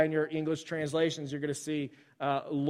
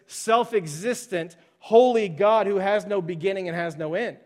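louder, driving preacher voice; full-force preaching; 5 semitones higher, 6 dB louder than usual. Acted. Pastor drives the point home to his congregation, preaching loud. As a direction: hammer the point home to the church.

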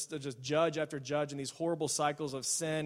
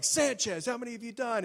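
higher, hammed-up angry demand; furious boss; 7 semitones higher than usual. Boss demanding numbers from Sanchez, mock angry. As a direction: call him out by name. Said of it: "he's irate and he's furious".

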